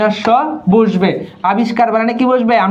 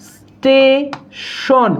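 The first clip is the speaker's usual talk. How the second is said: "Station" stretched very slowly, syllable by syllable; drawn-out demonstration; much slower than usual. Shown as a demonstration. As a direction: linger on the opening of "station", pause, then finish it. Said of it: "এই বানানগুলোতে কি বসবে?".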